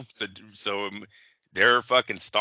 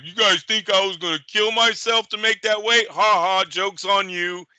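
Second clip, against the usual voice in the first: high, taunting mock boast; mocking the fighter; 9 semitones higher than usual. Host mocking the fighter's gloating post, high taunting voice. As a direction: sneer it out like a smug cheater gloating. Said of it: "where he's like".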